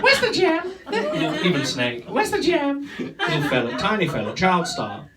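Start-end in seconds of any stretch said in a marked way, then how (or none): 0.0-0.7 put-on child star catchphrase. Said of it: "Do you remember him?". 2.1-2.9 put-on child star catchphrase. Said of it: "Do you remember him?".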